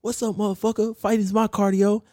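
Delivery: high-pitched imitation of a gym guy's war cry; mock screaming. Man at the gym throwing punches with free weights, mock screaming. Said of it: "he starts screaming".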